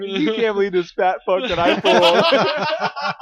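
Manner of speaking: laughing brag. Retold or imitated. Retelling the schoolgirl bragging to friends, laughing. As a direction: crow about it through laughter. Girl bragging about the scare.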